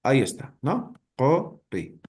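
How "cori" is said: "Cori" split into two syllables, co and ri; vowel lowered to O. Shown as a demonstration. Teacher sounds out the word for students, vowel lowered to O. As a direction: sound out "Cori" with O, then clear I. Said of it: "se va a pronunciar como si fuera la vocal O".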